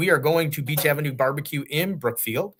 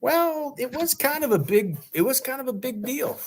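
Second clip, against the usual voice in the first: much higher, mock-serious voice; high mock drama; 12 semitones higher than usual. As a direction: ham up how big a deal it was. Mock-dramatic about the crash.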